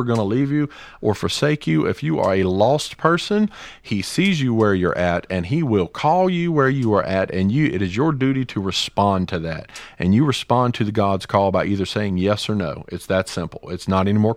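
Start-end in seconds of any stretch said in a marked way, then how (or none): none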